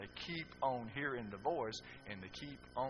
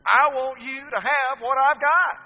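huge, slow, high shout; preacher's roar; booming quote; 11 semitones higher, 16 dB louder, slower than usual. Acted. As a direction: thunder the line out to the crowd.

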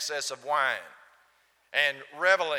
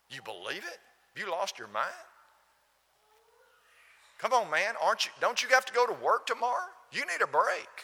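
worldly scoffer; 6 semitones higher than usual. Retold or imitated. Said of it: "That's the way the world talks".

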